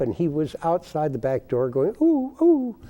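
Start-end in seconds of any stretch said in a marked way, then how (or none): 2.0-2.8 high imitated dog whine; imitating a dog's high whimpering. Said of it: "like that".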